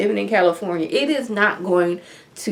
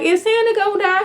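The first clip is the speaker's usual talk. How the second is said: put-on high, anxious little kid asking; worried child voice; 13 semitones higher than usual. Kids asking the paramedic about Santa, high and worried. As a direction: voice a frightened child, pitched way up.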